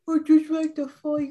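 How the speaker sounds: put-on high, giddy little-kid voice; squeaky pyromaniac kid; childish fire lover. Teasing, playing a fire lover.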